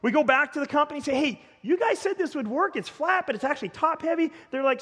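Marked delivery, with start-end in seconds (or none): 1.1-4.3 high-pitched acted protest; indignant complaint. Pastor goes back to the company to complain, acted indignation. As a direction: push back, lightly indignant.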